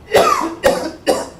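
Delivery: harsh breathless cough; coughing fit. Real, not acted. Vlogger after his warm-up set, coughing hard. Winded during workout.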